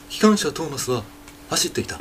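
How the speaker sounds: soft, trailing Morimoto Leo narration, mildly done; teacher does Morimoto Leo narrating Thomas. Shown as a demonstration. 森本レオ風に柔らかく語る. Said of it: "例えば森本レオとか".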